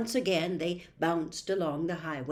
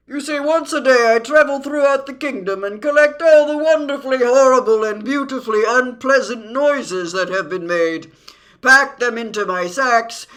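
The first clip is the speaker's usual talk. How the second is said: loud, high creature voice; boastful din; 4 semitones higher, 11 dB louder than usual. Acted. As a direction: proudly proclaim the noise rounds at full volume.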